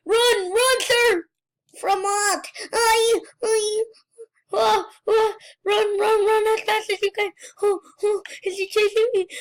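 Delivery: high, urgent cries while fleeing; panicked. Real, not acted. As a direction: shout frantically as if being chased. Fleeing the killer in game.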